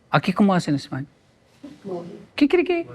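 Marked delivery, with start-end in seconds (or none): 2.3-2.9 high squeaky kikiriki crow; playful mock rooster call. Imitating rooster crow again.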